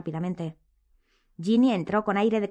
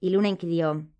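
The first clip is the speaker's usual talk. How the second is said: ordinary delivery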